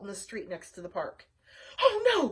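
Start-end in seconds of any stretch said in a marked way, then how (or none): 1.8-2.3 high, loud "oh no"; alarmed cry. Acted. Wanda the rose sees the truck, high alarmed cry. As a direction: gasp it out in alarm. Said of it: "cried Wanda".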